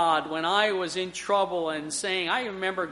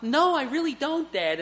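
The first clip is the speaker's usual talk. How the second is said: mock innocent denial; 8 semitones higher than usual. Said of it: "Do you know what this is about".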